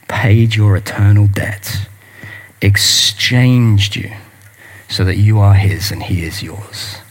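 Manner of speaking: slow, hushed closing appeal; earnest appeal. Acted.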